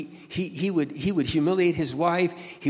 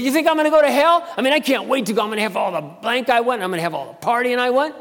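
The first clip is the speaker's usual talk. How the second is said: high, loud mocking brag; scoffing boast; 10 semitones higher, 7 dB louder than usual. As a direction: crow about it, higher and louder. Coworker boasting about hell.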